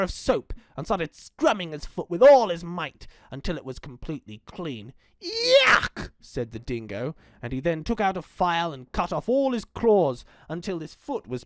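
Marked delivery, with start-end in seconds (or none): 5.2-6.1 very high yelp of disgust; disgusted cry. Acted. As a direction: recoil from the smell. Scrubbing smelly foot.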